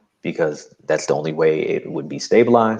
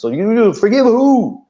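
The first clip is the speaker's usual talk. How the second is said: incredulous mock question; 7 dB louder than usual. Mocking the voice in his head.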